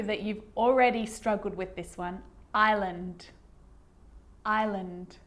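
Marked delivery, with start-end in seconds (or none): 2.5-5.2 "Island" as EYE-lund, no S; careful repeated model of "Island" without any hiss; silent S. Shown as a demonstration. Teacher on a word learners already struggled with, careful model. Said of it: "an S can also be silent".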